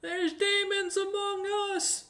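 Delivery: very high, slow wailing cry from afar; screaming in panic. Acted. Shopkeeper alerting the town, high panicked scream. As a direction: wail the alarm across town.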